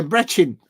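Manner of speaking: "Brechin" with a short E and a ch sound; "Brechin" said the local way, short E. Shown as a demonstration. Said again as the viewer corrected, "Brechin" with a short E. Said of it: "That's how you say it there".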